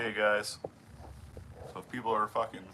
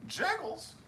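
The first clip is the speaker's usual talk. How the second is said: "Jiggles?" jumps high with a falling end; amused disbelief. Real, not acted. Streamer repeating the viewer's funny name, high and incredulous.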